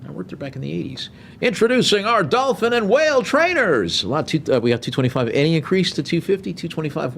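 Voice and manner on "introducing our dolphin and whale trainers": high, ringing big-show introduction; show announcer. Acted. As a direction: announce the act to a whole arena. Auctioneer mimics show announcer's introduction.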